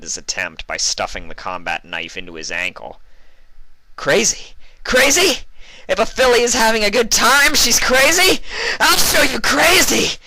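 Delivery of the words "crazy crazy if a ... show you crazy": unhinged rage, climbing; crazed furious howl; manic howl. A narrator's reading. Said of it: "Vinyl howled".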